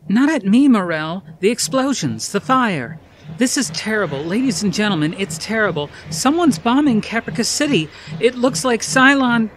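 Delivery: high, loud, urgent reporter's cries; alarmed yelling. Acted. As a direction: yell at the cameraman, then report in panic. Reporter under bombing, yelling to cameraman.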